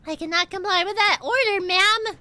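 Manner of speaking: high, loud defiant soldier voice; defiant lieutenant. Acted.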